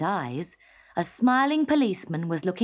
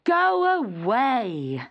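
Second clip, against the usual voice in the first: slow, drawn-out mock shout; a lightly shouted order to leave; teacher shouting; 4 semitones higher, 4 dB louder, much slower than usual. A narrator's reading. Teacher shouting at Teddy over the vase, mild shout. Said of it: "his teacher shouted".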